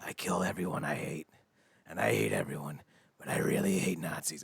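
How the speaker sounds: low, soft killer confession; understated menacing deadpan; Sweet Tooth. Acted. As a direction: say it quietly and low, matter-of-fact. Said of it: "Sweet Tooth goes".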